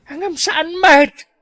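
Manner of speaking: rising high, ending in a loud cry; mother's exasperated outburst; exasperated shout. Acted. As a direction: cry out in despair at him.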